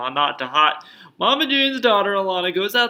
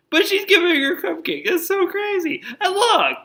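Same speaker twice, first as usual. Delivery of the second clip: high-pitched giggling outrage; laughing disbelief; 4 dB louder than usual. Real, not acted.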